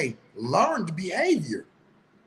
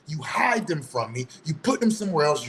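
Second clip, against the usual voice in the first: ordinary talk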